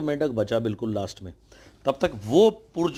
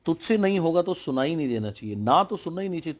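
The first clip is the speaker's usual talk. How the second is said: usual speaking voice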